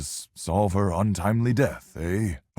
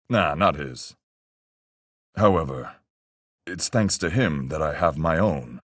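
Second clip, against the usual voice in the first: ordinary delivery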